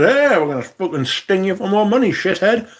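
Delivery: mocking the provider's message; mock phone company. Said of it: "My mobile phone provider has just contacted me and said".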